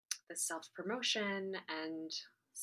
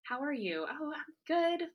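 higher, louder mock-cheery small talk; chirpy cover-up act, pitched up; putting on fine; 6 semitones higher, 5 dB louder than usual. Actor making small talk over drinks after the play, putting on fine. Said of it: "and just being".

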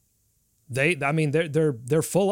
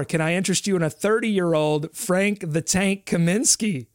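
salesman pitch; 3 semitones higher than usual. Pretend. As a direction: sell it like a showroom host. Pitching free-agent names like a salesman.

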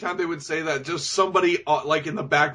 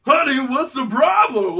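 mock oblivious husband; 8 semitones higher than usual. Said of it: "he's just like".

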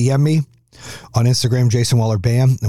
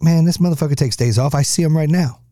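teasing friend; 6 semitones higher than usual. Retold or imitated. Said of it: "somebody that knows me was like".

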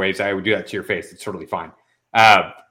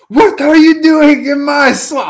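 much higher, louder, slow ogre bellow; 8 dB louder, slower than usual. Acted. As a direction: boom it out like an outraged ogre in his swamp. Swamp joke, movie ogre line.

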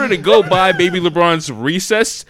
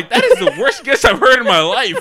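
laughing ridicule; 5 semitones higher, 5 dB louder than usual. Real, not acted. Mocking the guess while laughing.

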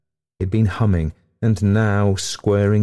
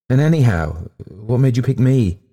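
ordinary delivery, nothing marked

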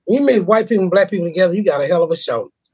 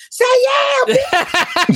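women yelling from the crowd; much slower than usual. Retold or imitated. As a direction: holler it like the crowd egging her on.

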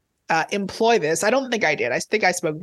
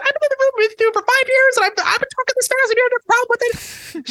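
much higher and louder, mock-outraged protest; indignant retort; 4 dB louder, faster than usual. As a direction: play up your offended disbelief. Retorting to the interpreter.

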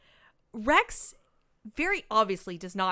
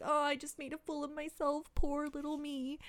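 high, soft mock self-pity; hammed-up tearful little voice; imitated crying; 6 semitones higher, 5 dB quieter than usual. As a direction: whimper it lightly, feeling sorry for yourself. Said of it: "she's crying, and she's like".

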